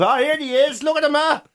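a touch of pub banter jeer; jeering pub mate. Retold or imitated. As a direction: rib your mate as he walks in. Pub mates slagging.

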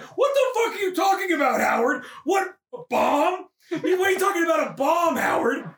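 high, mock-outraged questioning; incredulous wife. Wife confronting Howard about a bomb, incredulous outburst. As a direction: demand answers in hammed-up disbelief.

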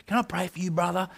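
lightly voiced earnest friend offering prayer; imitating mate's gentle ask. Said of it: "that's how he talks".

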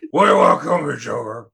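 mock Batman, loud start then falling away; hammed-up superhero impression. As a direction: burst out as the Dark Knight, then drop off. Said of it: "trying to do a Batman voice".

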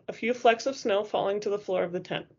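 usual speaking voice

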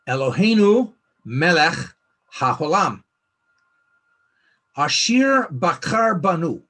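a measured, prayerful Hebrew blessing, slow. Shown as a demonstration. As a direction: recite it slowly so they can repeat. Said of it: "I'll say this slow".

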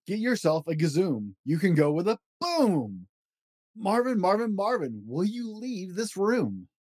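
lively read-aloud of a rhyming picture book; storybook voice, playful and rhythmic; reading to children. Acted. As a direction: bounce through the rhymes for a child at bedtime. Reads Dr. Seuss book aloud.

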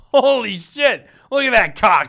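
high, loud mock kid shout; kids' voices. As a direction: imitate excited kids yelling. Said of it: "a bunch of kids said".

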